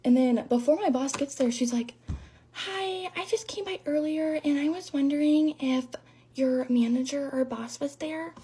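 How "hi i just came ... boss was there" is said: soft, high, fake-innocent phone voice; hushed mock politeness, drawn out at the end; sweet, low-energy caller. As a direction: imitate a suddenly meek caller. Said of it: "Where was that energy when you were yelling".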